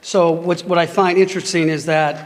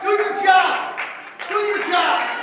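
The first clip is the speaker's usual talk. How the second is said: angry shout; 9 semitones higher, slower than usual. Real, not acted. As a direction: yell it as you're escorted out. Shouting at board being removed.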